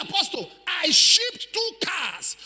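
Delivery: high, slow tearful plea; crying man's plea. Retold or imitated. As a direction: imitate a sobbing man. Crying man's retold words.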